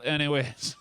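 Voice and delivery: normal talking voice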